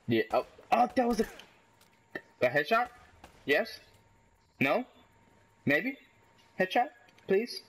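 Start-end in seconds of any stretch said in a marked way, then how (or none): 2.4-7.6 each word a separate rising question; hopeful questioning. Real, not acted. Player hoping for a headshot, "please?" in hopeful rising questions. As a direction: lift "headshot", "maybe" and "please" at the end, pausing between.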